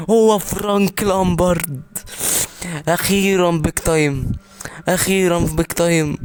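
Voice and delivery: screaming with delight at a lucky pull; a gamer losing it over his dream card; ecstatic pack reaction. Real, not acted. Pulls a long-awaited Frank Lampard card.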